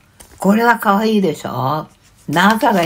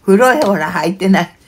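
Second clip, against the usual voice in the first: louder, outraged retort; indignant; 3 semitones higher, 6 dB louder than usual. Real, not acted. Streamer repeating the letter's insult, louder and indignant. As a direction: fling it out, offended.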